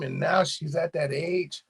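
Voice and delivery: ordinary talk, nothing marked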